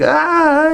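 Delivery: short, very high yelp of pain; mock scream. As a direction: yell like a chainsaw just hit your neck. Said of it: "He's like".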